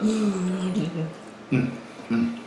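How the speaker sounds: chewing while humming; mouth full. Real, not acted. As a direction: hum through a mouthful of food. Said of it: "so I had to get that chewed up".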